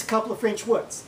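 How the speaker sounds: ordinary talk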